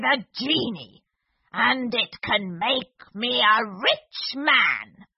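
slow, wavering villain voice; sly old magician. Acted. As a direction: creep out the secret slowly. Magician reveals plan.

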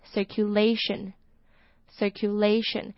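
circulation said slowly with the LA syllable stressed. Shown as a demonstration. Teacher drills the circulation stress for learners, slowly. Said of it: "重音在L A的地方".

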